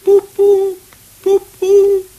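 high, loud hooted toots; motor horn imitation. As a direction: toot like an old car horn. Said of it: "Imitation of motor car".

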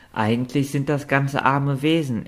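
plain reading voice

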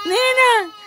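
high, loud call to the goat. Real, not acted. Owner calling the pregnant goat by name, loud and high. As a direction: call her name across the pen.

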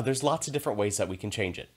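ordinary talk, nothing marked

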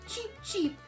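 ordinary delivery

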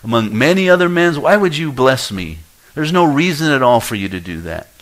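high, loud, humble pleading to God; pleading prayer. Pretend. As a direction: beg the Lord as mere dust. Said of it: "I talk to the Lord like this".

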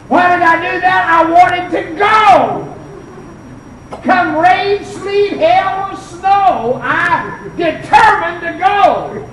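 high, loud pulpit delivery; forceful preaching. Real, not acted. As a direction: preach it with fierce conviction, stretching the last words.

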